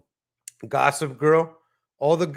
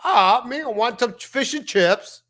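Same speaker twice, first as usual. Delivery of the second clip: high-pitched British caricature; mock them with a loud, hammed-up British accent; exaggerated, squawked British impersonation; 8 semitones higher, 4 dB louder than usual. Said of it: "Why you sound like that?".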